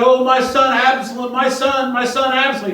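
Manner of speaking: high, loud mournful cry; loud lament; weeping king. Acted. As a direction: wail it like a father in grief. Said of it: "was much moved and went up to the chamber over the gate and wept".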